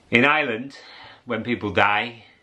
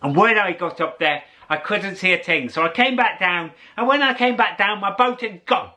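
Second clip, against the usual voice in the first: put-on high, lilting old fella telling a tall tale; old fisherman voice; 11 semitones higher than usual. Old fisherman boasting to the narrator about mist. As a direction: spin the yarn like an old Irish fisherman. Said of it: "He said".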